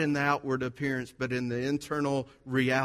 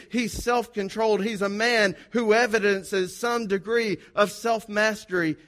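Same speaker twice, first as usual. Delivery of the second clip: raised, emphatic pulpit delivery, higher and a bit louder; preaching; 6 semitones higher, 3 dB louder than usual. Acted. Pastor listing an elder's virtues to the congregation, preaching at full force. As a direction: preach each virtue out to the church.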